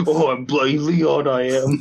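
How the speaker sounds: a cosplayer showing off, British; mock British accent. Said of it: "with that accent".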